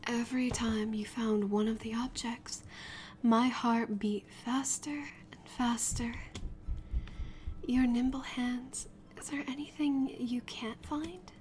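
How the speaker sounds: soft unvoiced whisper, slowing down; breathy whisper. Huntress whispering praise to you after the hunt. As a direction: hush it close, like a secret compliment.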